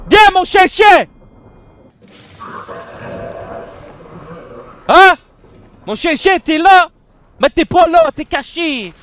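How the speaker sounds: loud, high calling for the dog. Real, not acted. Player calling the hidden dog loudly, calling out. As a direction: call out across the house.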